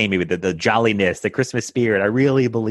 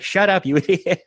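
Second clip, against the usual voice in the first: higher, laughing mock insult; friend laughing; 10 semitones higher than usual. The friend laughs back at her from below, higher voice. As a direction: toss off the insult through laughter. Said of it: "my friend's like".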